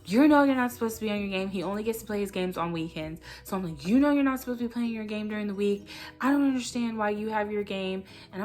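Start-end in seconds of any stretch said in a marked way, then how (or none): 0.1-1.5 louder, fast scolding rush; fast, louder upset scold, not quite yelling; raised upset voice. Retold or imitated. Mom scolding her son for sneaking his game, raised and fast. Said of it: "I have my voice raised because I'm upset". 3.9-8.0 louder, fast scolding rush; fast, louder upset scold, not quite yelling; raised upset voice. Retold or imitated. Mom scolding her son for sneaking his game, raised and fast. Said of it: "I have my voice raised because I'm upset".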